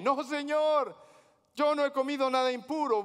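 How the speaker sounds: high, loud pleading refusal; exasperated Peter. Acted. Peter protesting to God, high and pleading. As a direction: whine out the refusal.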